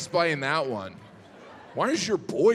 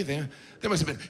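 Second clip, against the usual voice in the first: ordinary talk, nothing marked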